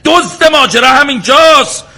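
high, loud furious demand; angry shout. Acted. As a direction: yell at the innkeeper to call the police. Guest demands they call police.

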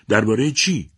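mother sharply asking what they discussed; sharp question. A narrator's reading. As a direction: fire the question back. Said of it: "روزا تند پرسید".

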